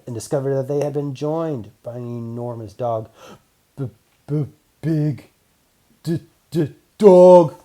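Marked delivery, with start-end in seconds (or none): 3.8-7.6 stuttering, rising to a loud yelp on dog; scared b-b-big d-d-dog, building to a cry; frightened stammer. Acted. Robot Oops stammers at the huge dog, scared. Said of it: "stammered Oops".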